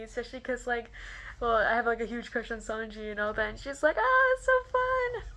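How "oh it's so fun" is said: very high, drawn-out gush; high and slow, thrilled; gleeful reply. Retold or imitated. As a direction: imitate a delighted squeal. Actor's cheerful reply retold.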